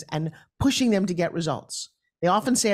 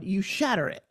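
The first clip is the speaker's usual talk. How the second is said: ordinary talk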